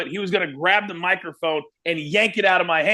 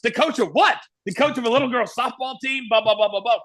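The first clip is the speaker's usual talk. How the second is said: higher, scoffing mock disbelief; mocking The Rock; 5 semitones higher than usual. The Rock ridiculing the new interviewer's nickname, higher scoffing mockery. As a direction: needle him with a higher, sneering repeat of his nickname. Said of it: "He's like".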